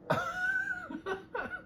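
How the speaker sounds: high-pitched laugh at herself; high giggle. Real, not acted. Laughs at own tearfulness.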